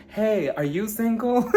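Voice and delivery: stretched-out flirty greeting, lightly hammed up; flirty come-on. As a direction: imitate a playful pickup line, slowly. Retold flirting with the bartender.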